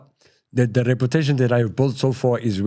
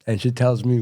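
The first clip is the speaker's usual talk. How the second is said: normal talking voice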